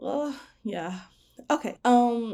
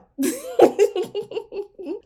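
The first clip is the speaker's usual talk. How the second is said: high laugh; 13 semitones higher, 8 dB louder than usual. Real, not acted. Laughs after mock crying.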